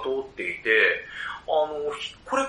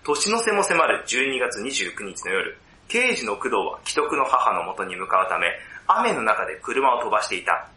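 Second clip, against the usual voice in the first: measured film-story narration with drawn-out phrase endings; synopsis narration; 3 semitones lower than usual. Acted. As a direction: narrate the plot deliberately, pausing between phrases. (あらすじを読み上げるナレーション調)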